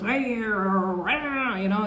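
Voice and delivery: very slow, stretched-out hey; dramatic American mouth. Retold or imitated. Vlogger showing dramatic American mouth movement, very slow and stretched. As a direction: drag the hey out like a mock American. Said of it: "and the mouth goes".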